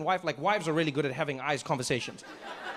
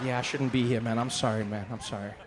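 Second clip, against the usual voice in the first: low, sheepish sorry; chastened apology; 8 semitones lower than usual. Retold or imitated. Visitor apologizing to angry host, low and sheepish. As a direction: back down meekly, voice dropped low.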